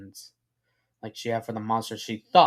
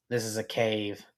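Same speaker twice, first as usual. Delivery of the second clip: low, softer stern statement; quietly firm, pitched down; 4 semitones lower, 5 dB quieter than usual. A narrator's reading. Filly answers the figure claiming a house, mildly stern. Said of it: "she sternly says".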